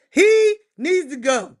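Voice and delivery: lauter, langsamer Nachdruck. Real, not acted. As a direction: say it again, slow and hard. Repeated louder, slower.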